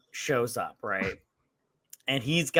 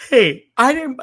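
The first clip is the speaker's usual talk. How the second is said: higher, louder innocent protest; mock innocent bear; 10 semitones higher, 8 dB louder than usual. The bear protests its innocence, mock innocent. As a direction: play the bear shrugging it off.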